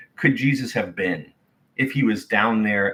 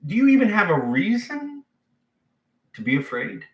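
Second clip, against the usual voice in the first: disbelieving question; 10 semitones higher than usual. Retold or imitated. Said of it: "Jesus was like".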